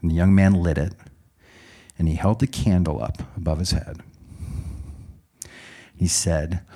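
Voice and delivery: ordinary talk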